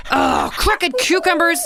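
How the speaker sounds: high, slow exasperated huff; a grumpy girl huffing past her brother; huffy girl. A narrator's reading. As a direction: huff it out, annoyed. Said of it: "Christy huffed".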